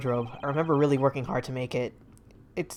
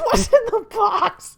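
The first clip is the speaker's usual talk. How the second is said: high, loud, drawn-out mock yell; hammed-up shout; 7 dB louder, slower than usual. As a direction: wail it frantically for laughs. Acted movie line, escalated shout.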